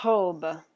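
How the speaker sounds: "Robe" in French, R from the throat; French guttural R. Shown as a demonstration. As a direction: loudly model "Robe", the R vibrating in the throat. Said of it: "That's the French guttural R".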